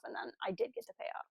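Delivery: ordinary delivery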